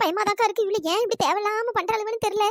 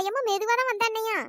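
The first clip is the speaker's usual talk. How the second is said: ordinary talk, nothing marked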